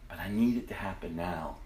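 soft devotional. Acted. Quiet devotional reflection.

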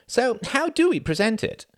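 put-on gruff older man. Sam pressing Reginald on the plan. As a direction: ask it bluntly as old Sam.

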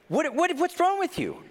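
high-pitched indignant challenge; mock affronted. Friend reacting to a scoffing noise, mock affronted. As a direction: react high, stammering into the question.

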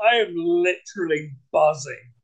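usual speaking voice

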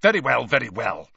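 exasperated teacher cutting in; snapping. A narrator's reading. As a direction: give in with sharp impatience. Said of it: "Snape snapped".

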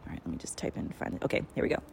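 half-voiced aside while typing; muttering to self. Real, not acted. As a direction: murmur under your breath.

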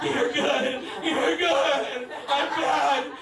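high, loud, laughing reassurances; flustered reassurance. Retold or imitated. Comic telling the crowd how he reassured the masseur, high and flustered. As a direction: fluster through it, repeating yourself.

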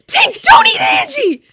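very high, loud, slow panicked shriek; panicked scream. Retold or imitated. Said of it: "Abby just screams".

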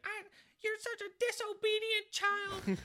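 very high, loud mock scolding; high-pitched angry mother parody; imitating scolding mom. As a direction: berate him like a furious caricature mom. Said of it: "and then she's like".

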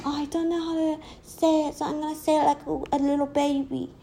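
high-pitched put-on baby voice; baby talk. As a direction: mimic a girl talking like a baby. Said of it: "do you talk like a baby?".

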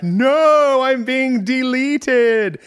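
hammed-up high cry of panic, drawn out slowly; mock panic. Consciousness flying off, wailing about its deletion in mock panic. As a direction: play a mind wailing as it gets erased, tongue in cheek.

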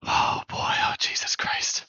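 slow dismayed whisper. Real, not acted. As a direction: breathe it out in disbelief. Player reacts to bad roll.

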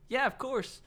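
high-pitched imitation British reply; mock British accent. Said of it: "I'm sorry, he's British".

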